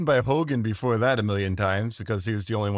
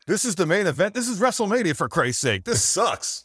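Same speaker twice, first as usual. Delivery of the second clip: Gene outtake rant; 8 semitones higher than usual. Retold or imitated. Imitating Gene's outtake rant.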